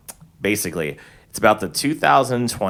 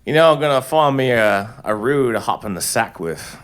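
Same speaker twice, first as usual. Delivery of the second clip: put-on Australian accent; 4 semitones higher, 4 dB louder than usual. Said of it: "What about you, Elliot?".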